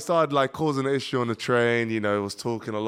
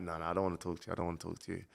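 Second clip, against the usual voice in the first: quiet refusal; 5 semitones lower, 14 dB quieter, much faster than usual. Retold or imitated. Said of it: "And I'm like".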